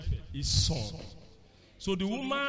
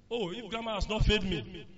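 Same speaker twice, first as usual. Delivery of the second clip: normal talking voice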